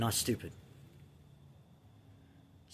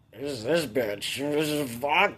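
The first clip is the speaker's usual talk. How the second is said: slow, incredulous and louder; indignant kid; 3 semitones higher, 5 dB louder, slower than usual. Retold or imitated. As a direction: scoff in disbelief at the caller. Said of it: "I was just like".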